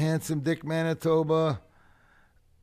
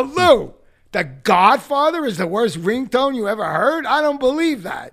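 high, shouted incredulous comeback; loud disbelief; 11 semitones higher, 12 dB louder than usual. Retold or imitated. Friend challenging a rock star's ringtone verdict, loud disbelief. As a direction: throw it back in outraged disbelief. Retold disbelieving reply to Lou.

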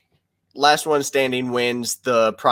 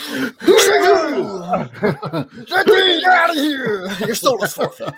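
villain shout; 13 semitones higher than usual. Retold or imitated. Acting out villain lines.

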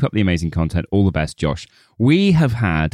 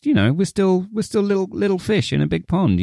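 squeaky higher little voice; small high voice; 10 semitones higher than usual. Real, not acted. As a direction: sound tiny and humble. Self-deprecating small voice.

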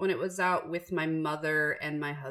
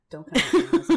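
louder, higher, laughing through the words; laughing defiance; 10 semitones higher, 8 dB louder, much faster than usual. Real, not acted. Host jokes at Amazon about the extra book, laughing loudly.

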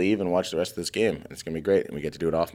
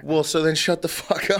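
laughing; 10 semitones higher than usual. Real, not acted. Laughing retort in argument.

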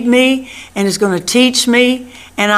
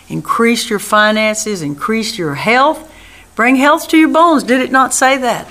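ordinary talk, nothing marked

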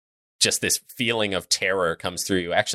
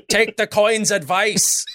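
laughing; 13 semitones higher than usual. Acted. GM laughing in dwarf voice.

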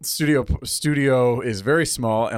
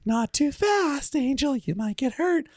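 gentle Fluttershy; slower than usual. Retold or imitated. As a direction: coax a pet bunny tenderly.

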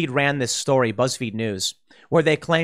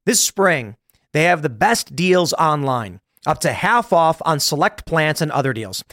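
upbeat sponsor read, slightly louder; 3 dB louder than usual. Acted. Host reading a sponsor ad to listeners, upbeat pitch. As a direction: sell the deal to listeners.

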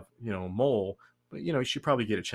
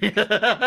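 burst of laughter; 12 semitones higher, 10 dB louder than usual. Real, not acted.

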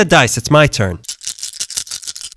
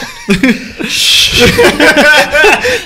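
high laughing burst; 13 semitones higher than usual. Real, not acted. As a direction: burst out cackling. Big laugh.

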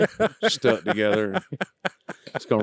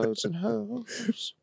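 high, slow and soft; high drawn-out quote; 10 semitones higher, 10 dB quieter, much slower than usual. Retold or imitated. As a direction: stretch it out way up high.

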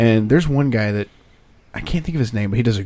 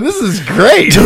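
excited; 9 semitones higher, 4 dB louder, much slower than usual. Acted. Excited reaction while laughing.

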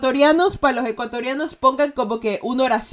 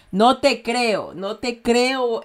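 very slow, drawn-out "No te creo"; slow sarcasm; much slower than usual. Shown as a demonstration. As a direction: stretch each word out mockingly.

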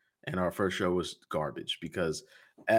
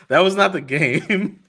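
loud laughing protest; 9 semitones higher, 9 dB louder than usual. Real, not acted. Laughing correction of a host.

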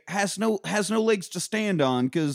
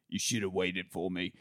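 much lower, softer mock whine; mumbled whine; 11 semitones lower, 10 dB quieter than usual. Lloyd sulks at his ex, low mumbled whine. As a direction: sulk it under your breath. Lloyd's whiny reply, retold.

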